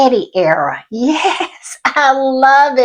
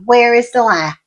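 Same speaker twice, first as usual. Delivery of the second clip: normal talking voice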